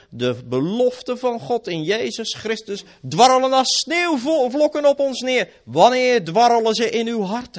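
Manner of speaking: loud, high fiery sermon; full-force preaching. Acted. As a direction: thunder it from the pulpit.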